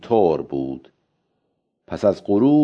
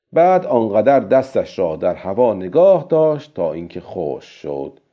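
ordinary delivery

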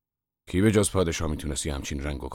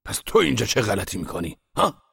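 loud, higher, furious challenge; angry master; 7 semitones higher, 9 dB louder than usual. Acted. Master rounding on his apprentice, loudly angry. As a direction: explode at the useless apprentice. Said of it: "از سر و صورتش معلوم بود که حسابی عصبانیه".

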